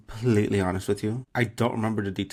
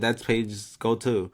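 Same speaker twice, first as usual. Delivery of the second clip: usual speaking voice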